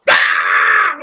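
loud, high shout of shock; startled yell. Retold or imitated. Two actors finding each other behind a door, startled yell.

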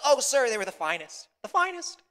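hammed-up wail, high and loud; mock crying. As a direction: lament loudly, pitch climbing at the end. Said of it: "Puss begins to cry".

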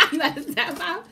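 high, loud laughing question. Real, not acted. Laughing during mukbang banter.

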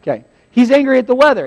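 ordinary delivery

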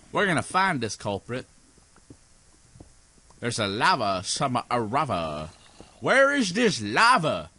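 ordinary talk, nothing marked

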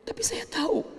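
hushed whisper; an orphan whispering trust in God; whispered prayer. Acted. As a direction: breathe it softly as a private prayer.

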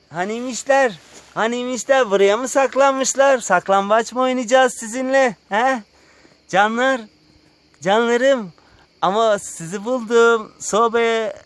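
high, loud peekaboo voice. Real, not acted. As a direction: play hide-and-seek with little ones. Playing hide-and-seek with animals.

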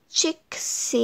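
soft breathy aside; whisper. A narrator's reading. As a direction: drop it to a whisper. Whispered list item.

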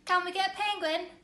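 high, loud and quick eager plea; child begging mum for a penguin, eager; eager asking. Acted. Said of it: "I say".

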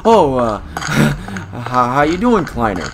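high, loud, stammering false cheer; nervous stalling. Acted. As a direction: stammer a guilty, casual greeting. Caught culprit feigns casual greeting.

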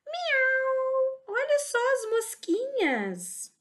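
usual speaking voice

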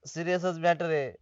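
low, very soft and sombre; hushed, doleful admission; grave. Acted. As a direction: confirm bad news under your breath. Confirms his grave news.

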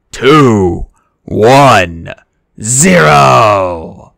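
a big, booming countdown to launch; loud countdown. Acted. Character counts down to release the flood, loud and excited. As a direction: count it down loud, building to the blast.

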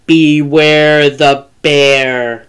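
slow, low, drawn-out growl; menacing growly bear drawing nearer; growly bear, closer. A narrator's reading. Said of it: "the voice came closer".

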